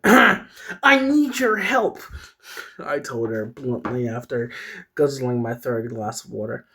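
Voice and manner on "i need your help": slow, high, louder character voice; high-pitched pleading for help, stretched out; Peyton. Acted. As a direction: plead in a drawn-out, put-on high voice. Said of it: "that's not what Peyton sounds like".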